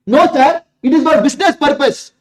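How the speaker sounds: shouted answer. Acted. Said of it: "Don't shout".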